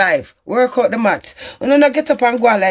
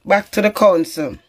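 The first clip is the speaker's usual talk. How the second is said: usual speaking voice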